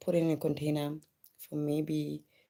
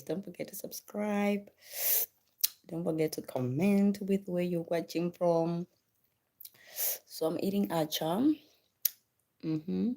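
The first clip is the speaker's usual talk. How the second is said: ordinary talk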